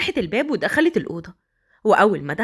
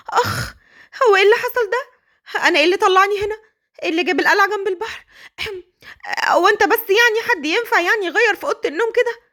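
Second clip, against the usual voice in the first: much higher, embarrassed babbling; high and rushed, caught off guard; flustered young wife; 9 semitones higher than usual. Acted. Flustered wife sees him undressed. (صوت زوجة مرتبكة ومحرجة بنبرة عالية)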